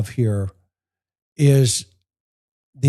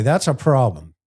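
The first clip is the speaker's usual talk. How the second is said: ordinary delivery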